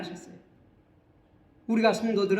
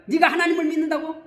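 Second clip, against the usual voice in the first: loud, high-pitched mock accusation; acted accusing taunt; 6 semitones higher, 5 dB louder than usual. Worldly accuser taunting a believer's faith, loud and scornful. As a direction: jeer at the believer as an accuser, voice raised. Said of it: "참소하는 거예요".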